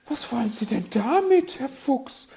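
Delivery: high, loud alarmed question; alarmed bird lady, voice way up; panicked magpie. A narrator's reading. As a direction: blurt it out in piping alarm. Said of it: "wurde die Elster panisch".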